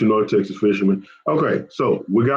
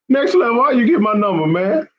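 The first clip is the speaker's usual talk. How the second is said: mock outrage; 11 semitones higher than usual.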